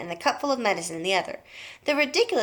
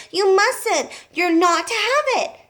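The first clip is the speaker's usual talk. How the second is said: urgent plea; 11 semitones higher, 5 dB louder, slower than usual. A narrator's reading. George pleads.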